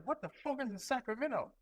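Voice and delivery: high, incredulous outburst; what on earth is that place; baffled. Retold or imitated. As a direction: disbelief at a strange posting. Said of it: "I was like".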